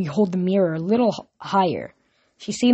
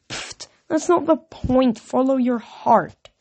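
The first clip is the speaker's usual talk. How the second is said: higher, slow, airy pfft; airy dismissal; 6 semitones higher, slower than usual. A narrator's reading. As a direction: brush it off like nothing. Aphrodite waves off objection.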